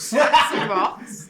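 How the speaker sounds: put-on slow, loud, laughing Scouse accent. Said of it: "That's your Essex accent".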